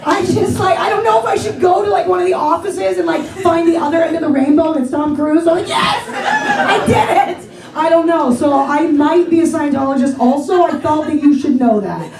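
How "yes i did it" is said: squealed up high and slow; a giddy fangirl win; mock triumph. Fan finally meeting Tom Cruise, squealing with mock triumph.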